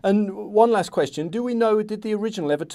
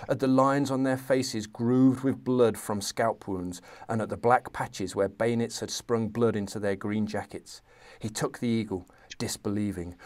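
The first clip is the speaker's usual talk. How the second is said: reading a novel aloud, steady and measured; story narration; 6 semitones lower, 6 dB quieter than usual. A narrator's reading. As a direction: tell the battle scene like a storyteller. Reads Sharpe excerpt aloud.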